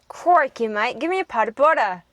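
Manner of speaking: loud start in a broad mock Australian; Australian accent. Shown as a demonstration. Sibling taking a turn at the requested accent, mock Australian. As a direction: copy an exaggerated Australian accent. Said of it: "The Australian".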